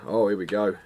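normal talking voice

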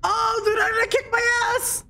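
high-pitched alarmed wail; panicked cry. Real, not acted. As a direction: cry out that you are doomed. About to be beaten in game.

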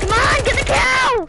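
high, loud urging shout; excited shout. Real, not acted. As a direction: cheer the teammate on loudly. Urging teammate mid-fight.

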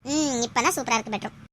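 usual speaking voice

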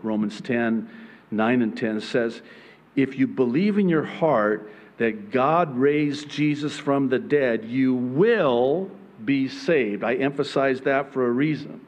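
usual speaking voice

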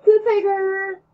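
loud, high whoop; gleeful shout ending the chapter; excited yell. Real, not acted. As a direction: cheer it out. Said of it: "I yelled".

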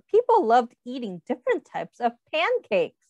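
usual speaking voice

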